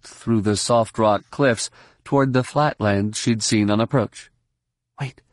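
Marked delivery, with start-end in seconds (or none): 5.0-5.2 hushed warning murmur; brief, discreet murmur. A narrator's reading. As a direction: murmur it under your breath. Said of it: "she murmured".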